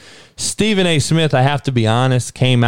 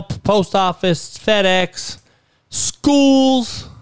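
heated rant; 7 semitones higher than usual. Real, not acted. Ranting about shootings everywhere.

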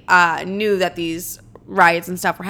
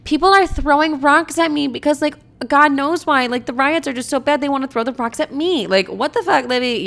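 self-absorbed whining; 6 semitones higher than usual. Retold or imitated. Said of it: "I love how, like, self-absorbed I am".

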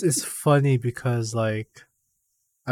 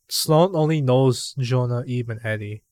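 ordinary talk, nothing marked

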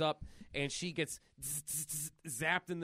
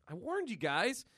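higher, distressed warning; a hint of a scared kid; frustrated girl; 9 semitones higher than usual.

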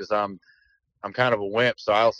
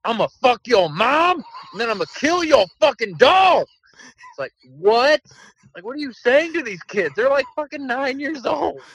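mock inmate threat; 13 semitones higher, 4 dB louder than usual. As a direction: ham up a jail inmate threatening kids. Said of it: "dudes are in there just like".

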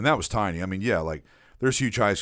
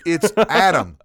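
high, louder exasperated correction; 7 semitones higher, 7 dB louder, slower than usual. Retold or imitated. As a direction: set him straight, fed up. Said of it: "I'm like".

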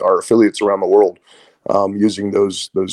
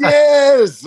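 put-on Portuguese accent; 5 dB louder than usual. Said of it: "the Portuguese accent".

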